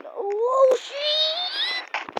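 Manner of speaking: high, loud panicked shriek; panicked scream. Real, not acted. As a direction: scream as everything goes wrong. Almost dying in game.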